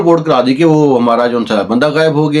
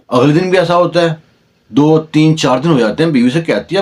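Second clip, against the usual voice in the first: usual speaking voice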